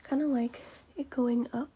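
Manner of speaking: usual speaking voice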